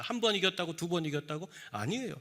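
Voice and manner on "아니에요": ordinary talk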